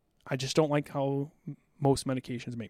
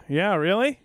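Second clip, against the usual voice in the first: mock surprise; 6 semitones higher, 7 dB louder, slower than usual. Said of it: "And I go".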